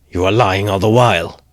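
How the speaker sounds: loud accusing cry; cried out. A narrator's reading. Raskolnikov crying out that the lawyer lies. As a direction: fling the accusation at him. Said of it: "he cried".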